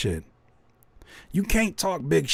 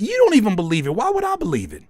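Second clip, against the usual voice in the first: ordinary delivery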